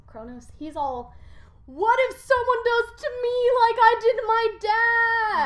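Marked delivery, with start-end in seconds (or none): 1.6-5.5 high worried voice; paranoid king. Retold or imitated.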